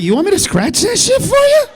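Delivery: high, loud, eager offer. Retold or imitated. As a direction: sound way too keen to help. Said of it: "I was like".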